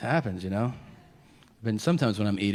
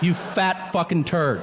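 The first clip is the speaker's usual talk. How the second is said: slow self-insult; 6 semitones higher, 4 dB louder, slower than usual. Retold or imitated. Scolding himself.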